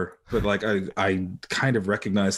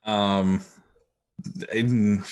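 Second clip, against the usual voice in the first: normal talking voice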